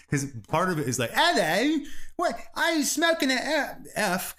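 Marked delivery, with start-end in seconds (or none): 1.1-4.4 high, hammed-up English accent, slow at the end; mock posh Brit asking, much higher. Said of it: "'Cause they call it that in Britain".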